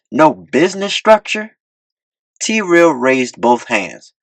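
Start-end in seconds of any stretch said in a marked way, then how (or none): 0.1-1.6 aggressive disbelief. A narrator's reading. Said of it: "pointing aggressively".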